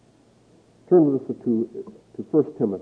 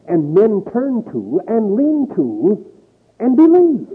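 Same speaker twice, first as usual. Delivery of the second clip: preaching swell; 7 semitones higher, 5 dB louder, slower than usual. Acted. Sermon climax on false belief.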